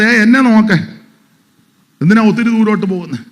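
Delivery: quick, urgent quoted rebuke; voicing God. God telling the listener to look at him, quick and urgent. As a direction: rattle it off as God calling out.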